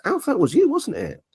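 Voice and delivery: high, teasing voice for Steve; lightly voiced friend catching him out; knowing friend, sung up high; friend on the phone.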